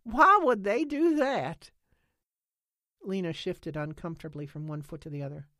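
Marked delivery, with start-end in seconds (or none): 0.0-1.7 much higher, louder, slow and incredulous; baffled outcry. A narrator's reading. Nance asks why aliens would, loud and baffled. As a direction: ask it as if it makes no sense.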